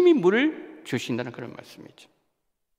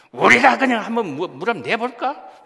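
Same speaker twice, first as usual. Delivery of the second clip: angry Moses; 6 semitones higher, 7 dB louder than usual. Retold or imitated. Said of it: "모세가 지금 화가 났어요".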